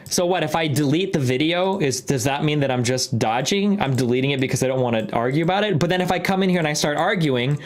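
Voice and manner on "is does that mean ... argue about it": normal talking voice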